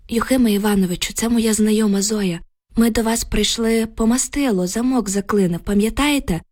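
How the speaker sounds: ordinary delivery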